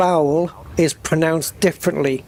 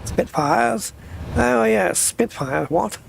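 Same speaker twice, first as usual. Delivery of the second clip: higher-pitched mock vintage British voice; old British news accent; 6 semitones higher, slower than usual. Riffing on Spitfires, old British news accent. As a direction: play an old British wartime type, pitch jumping up. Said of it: "that way of talking when they're reporting the news".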